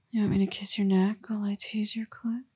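ordinary talk, nothing marked

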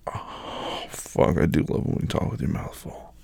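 soft, intimate murmur to a partner; hushed and indulgent; breath-heavy whisper. Acted. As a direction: let it out on a whispered sigh.

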